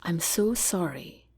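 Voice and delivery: slow, softened, understated apology; choked-up apology. A narrator's reading. General apologizes to Jack after swallowing a sob, choked up. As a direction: hold back a sob as you apologize softly. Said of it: "He pauses to swallow a sob".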